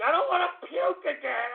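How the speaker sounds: hammed-up queasy whine; mock whimper. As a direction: whimper it like a sick kid. Same plea said twice.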